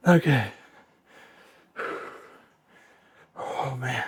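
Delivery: breathless and winded; panting between words; out of breath. Real, not acted. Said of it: "I'm out of breath".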